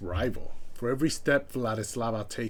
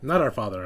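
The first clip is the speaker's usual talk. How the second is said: Not said louder and sharply falling; stress on Not; 6 dB louder, faster than usual. Real, not acted. Correcting his own slip, stress on "Not". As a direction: hit "Not" to correct yourself. Correcting himself mid story.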